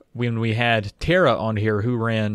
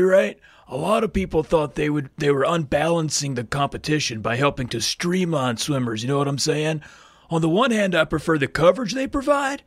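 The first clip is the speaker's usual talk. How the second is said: surfer-dude impression, higher; Keanu; 4 semitones higher than usual. Hopeful swimmer asking about full-body suits, surfer-dude impression. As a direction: play a laid-back film surfer, pitched up. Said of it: "maybe I'll do a little Keanu".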